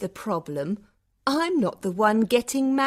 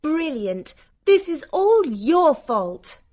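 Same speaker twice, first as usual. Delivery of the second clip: high, loud sarcastic blame; angry shout; 6 dB louder than usual. A narrator's reading. Passenger blaming bride-to-be for missed exit, shouting. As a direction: burst out shouting the accusation. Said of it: "shouted Vanessa at Shauna".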